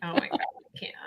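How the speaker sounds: breathless whisper. Real, not acted. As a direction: whisper it, overwhelmed. Overcome watching the photos.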